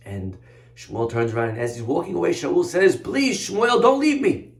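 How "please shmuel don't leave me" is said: high, louder plea; desperate begging. Acted. King calls after the prophet walking away, begging desperately. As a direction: beg them to stay.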